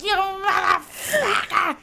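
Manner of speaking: slow, high mock shout; inner yell. Mother's imagined shout at her kid, hammed-up fury. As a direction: drag out a furious curse. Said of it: "in my head, that's my voice".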